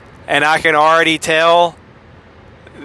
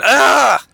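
high startled yelp; 9 semitones higher, 3 dB louder than usual. Shown as a demonstration. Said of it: "I'm gonna do that again".

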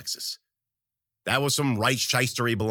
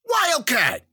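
high, loud shout; yelled name; 13 semitones higher, 6 dB louder than usual. A narrator's reading. Narrator calling to Wildcat in the brawl, yelling. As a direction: holler the name across the room. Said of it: "I yelled".

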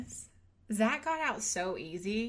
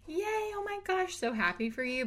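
high squealed congratulations; mock excitement; 11 semitones higher than usual. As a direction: gush as if thrilled for them. Acting congratulations nobody will give.

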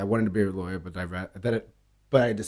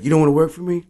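higher, louder challenge; angry challenge; 7 semitones higher, 5 dB louder, faster than usual. Retold or imitated. Manager challenging me to quit, higher and louder. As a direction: throw the question at him angrily. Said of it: "And he's like".

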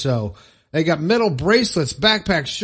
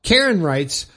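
ordinary delivery

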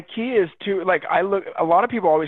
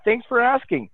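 enthusiastic answer; 5 semitones higher than usual. Retold or imitated. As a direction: sell how much you love your life. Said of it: "If your response is".

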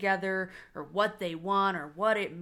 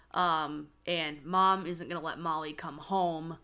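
ordinary delivery

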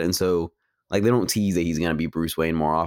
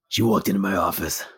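noir detective. Retold or imitated. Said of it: "he meets Catwoman and he was like".

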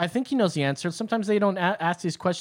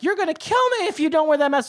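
much higher, fast mocking whine; mocking mask scolder; 12 semitones higher, 4 dB louder, faster than usual. Host mocks people scolding the unmasked, whiny and high. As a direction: parody a panicked scold.

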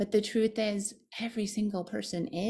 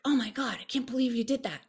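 inner critic; 6 semitones higher, faster than usual. Retold or imitated. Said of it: "this one voice that was like".

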